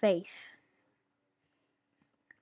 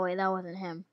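ordinary talk

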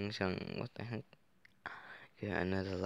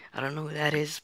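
put-on high girl voice; 7 semitones higher, 6 dB louder, much faster than usual. Voicing game girl, high.

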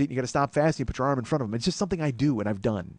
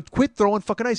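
higher and louder, firm; angry warning; 7 semitones higher, 5 dB louder than usual. Retold or imitated. Bouncer warning the ice thrower again, loud and firm. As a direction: repeat the order harder.

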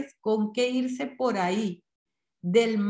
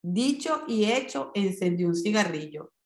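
ordinary delivery

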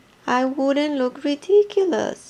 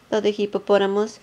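ordinary delivery

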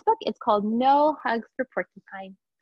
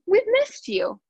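eager greeting; 10 semitones higher, slower than usual. Acted. Animals greeting porcupine.

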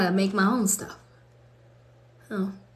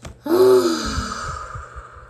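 loud, airy exhale; heavy sigh; 12 semitones higher, 5 dB louder than usual. Real, not acted. After saying amen in prayer, heavy sigh. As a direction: let out a long breath. Releases breath after praying.

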